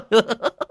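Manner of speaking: high mocking laughter; gleeful cackle. Old man watches the runaway bike, cackling with delight. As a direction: cackle at a friend's misfortune.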